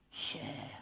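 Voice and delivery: soft unvoiced whisper; lips barely moving. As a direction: breathe the name with still lips. Dummy whispers the name.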